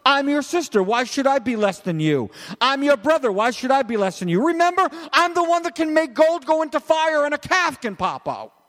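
high-pitched sibling outrage, hammed up; mock indignant protest. Preacher voices jealous sister and brother challenging Moses, mock indignant. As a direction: protest your importance in a high, affronted voice. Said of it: "And they said".

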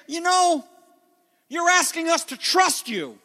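high, challenging mock crowd; skeptical crowd voice. Crowd demanding a sign from Jesus, high and challenging. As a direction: needle him like a doubting crowd. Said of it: "they're going to say".

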